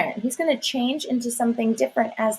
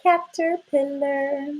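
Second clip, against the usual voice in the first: slow, drawn out; 3 semitones higher, slower than usual. Shown as a demonstration. Teacher to young pupils, slowly stretching the word. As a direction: say caterpillar slowly, each sound stretched. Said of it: "while we spell the word".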